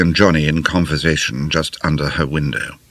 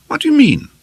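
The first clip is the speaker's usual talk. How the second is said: sharp, much higher outburst; flaring up; 5 dB louder, faster than usual. A narrator's reading. Lena flaring up at Johnny, sharp high retort. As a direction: fire back in indignation.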